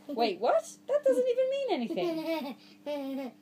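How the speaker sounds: wait, what, half laughing in disbelief; mock surprise. Reader balking at a silly word, mock surprise.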